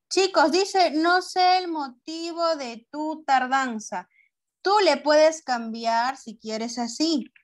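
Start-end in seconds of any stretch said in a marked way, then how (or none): 0.9-4.1 higher, slow but joined-up reading; reading aloud to class. Shown as a demonstration. Teacher reads the example to pupils, higher joined-up reading. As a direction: read the whole sentence out in one go.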